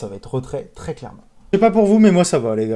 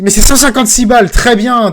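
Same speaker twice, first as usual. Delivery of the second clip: sudden loud, higher burst; excited win; 5 semitones higher, 9 dB louder than usual. Real, not acted. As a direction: call out the win, thrilled. Reacting to a slot win. (exclamation enthousiaste et forte)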